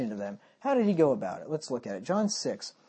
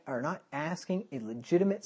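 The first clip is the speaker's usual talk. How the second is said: ordinary delivery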